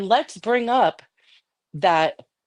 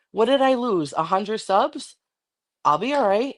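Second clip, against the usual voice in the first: usual speaking voice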